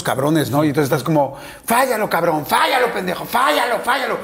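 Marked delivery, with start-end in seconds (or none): 1.6-4.2 mock fan yelling. Fan heckling a striker about to score, mock yelling. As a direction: chant it like a fan jinxing the striker. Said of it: "Y lo estás gritando".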